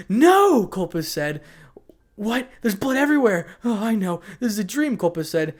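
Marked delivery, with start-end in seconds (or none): 0.1-0.7 high, very loud scream. Acted. Captive waking to carnage, screaming. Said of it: "Colpus suddenly started screaming". 2.1-5.0 high, loud hysterical disbelief; screaming panic. Acted. Captive seeing blood everywhere, screaming panic. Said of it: "Colpus suddenly started screaming".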